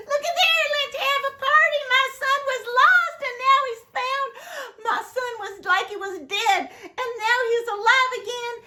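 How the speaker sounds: high, loud, fast jubilant cries; rejoicing. Acted. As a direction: celebrate the lost son, bursting with joy. Father rejoicing at the party.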